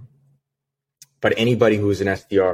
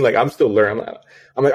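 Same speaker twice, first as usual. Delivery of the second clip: ordinary talk, nothing marked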